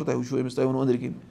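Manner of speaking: usual speaking voice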